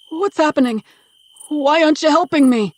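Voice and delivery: high, loud panicked plea. Acted. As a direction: beg your son for help in panic. Bound father pleads with son.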